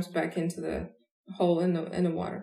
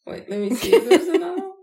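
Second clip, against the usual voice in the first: high, loud and fast through laughter; giggling while searching for one more joke; laughing; 11 semitones higher, 9 dB louder, faster than usual. Real, not acted. Hunting for another joke.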